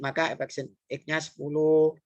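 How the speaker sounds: usual speaking voice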